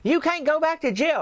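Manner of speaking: hammed-up yell into a karaoke mic; woman calling off a fight over a karaoke speaker; yelling through a microphone. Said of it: "she yelled through that".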